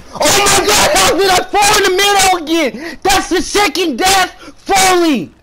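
high, exasperated outburst; real frustrated high yell; pissed off yelling. Real, not acted. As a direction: cry out in angry disbelief. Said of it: "I'm getting kinda pissed off".

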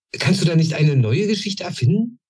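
put-on eager young goblin. A narrator's reading. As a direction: press him hard for an answer. Goblin asks hopefully. (viel höher, drängender kleiner Kobold)

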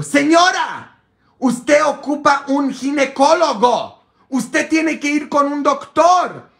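exasperated shout. Retold or imitated. Priest shouting at a woman to see a gynecologist. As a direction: blast her with the obvious answer.

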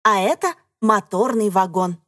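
ordinary talk, nothing marked

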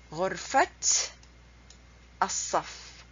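غرفة said "ghurfat", final t released, pause, then الصف; correct linked pronunciation. Shown as a demonstration. Teacher gives second example, غرفة pronounced "ghurfat". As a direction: sound the t at the end of غرفة. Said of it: "The way you pronounce it".

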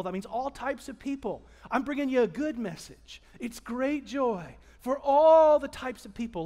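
ordinary talk, nothing marked

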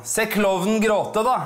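high, slow taunting call; mock heckle. Woman in the front row taunting the comic, slow and high. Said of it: "sier hun bare høyt".

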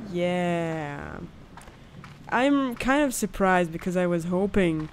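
usual speaking voice

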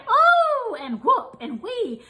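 high gleeful whoops; gleeful cries. A narrator's reading. Animals shouting for joy in the pool, high gleeful cries. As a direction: squeal them with delight. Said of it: "for they all cried".

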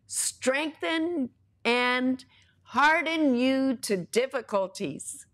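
slow, stretched words of promise; preacher stretching out the promise to listeners, slow; slow emphatic reading. Real, not acted.

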